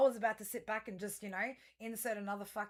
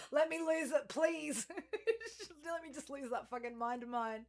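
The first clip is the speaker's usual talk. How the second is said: laughing through the words, higher; 5 semitones higher than usual. Real, not acted. Joking about losing her mind, laughing. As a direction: giggle while begging to lose it.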